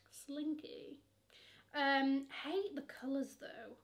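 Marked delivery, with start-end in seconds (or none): 0.1-1.0 breathy whispered single word; soft whispered slinky. Real, not acted. Whispered reaction to silk.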